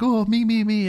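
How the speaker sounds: put-on high, loud, eager kids' voices calling out; be a room of kids desperate to be picked.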